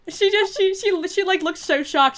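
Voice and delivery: high, loud, laughing retelling; laughing excitement. Real, not acted. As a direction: burst out laughing and tell it loudly. Amused after friend's surprise call.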